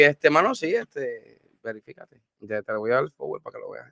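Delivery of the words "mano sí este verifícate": ordinary talk, nothing marked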